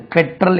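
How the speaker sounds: பெட்ரோல் as "PET-rul", short weak second syllable; correct pronunciation. Shown as a demonstration. Teacher telling how it must be pronounced, பெட்ரோல் as "PET-rul". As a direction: reduce the end of பெட்ரோல் to "trul". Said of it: "என்று உச்சரிக்கணும்".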